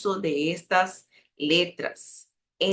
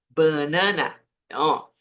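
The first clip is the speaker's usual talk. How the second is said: ordinary delivery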